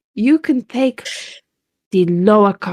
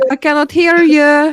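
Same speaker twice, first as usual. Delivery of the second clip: put-on higher, louder childish refusal to listen; immature taunt; 5 semitones higher, 4 dB louder than usual. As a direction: drag it out like a stubborn kid. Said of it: "[name]'s just done the immature thing".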